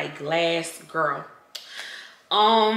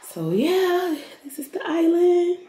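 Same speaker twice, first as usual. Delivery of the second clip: excited showing off; 11 semitones higher, slower than usual. Real, not acted. Showing off the island.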